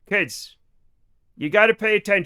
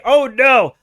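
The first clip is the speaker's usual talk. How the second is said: repeated oh no; 3 semitones higher, 6 dB louder than usual. Retold or imitated. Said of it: "I literally say".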